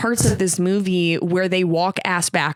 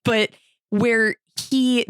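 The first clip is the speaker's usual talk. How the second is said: ordinary talk, nothing marked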